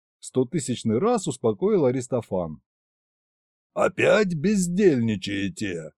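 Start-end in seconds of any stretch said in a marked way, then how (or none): 3.7-5.9 put-on slow, creaky old king. A narrator's reading. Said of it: "скрипучий голос Кощея".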